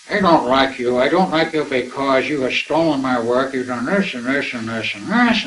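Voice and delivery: a hint of a drunk caller blaming him; drunken voice. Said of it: "in a very drunken tone of voice".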